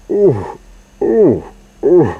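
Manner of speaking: high, sickly little moans. A narrator's reading. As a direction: moan like a sick little car. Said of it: "coughed a little sickly voice".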